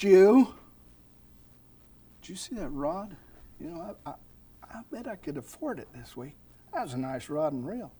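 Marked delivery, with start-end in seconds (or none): 2.2-7.9 tempting murmur. Acted. Voicing distracting thoughts in sermon.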